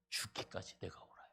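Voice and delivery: whispered, stubborn insistence; defiant whisper. Acted. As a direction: hiss out the final defiance. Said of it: "어떻게까지 옳아요?". (고집스러운 요나, 속삭이듯)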